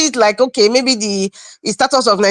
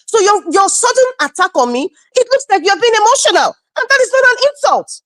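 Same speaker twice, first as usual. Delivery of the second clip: indignant protest; 9 semitones higher than usual. Real, not acted. Defending herself in an argument.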